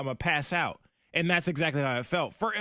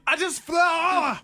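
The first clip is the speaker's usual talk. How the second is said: strained high exclamation; 14 semitones higher, 6 dB louder, much slower than usual. Real, not acted. Wasabi clip, voice after burn.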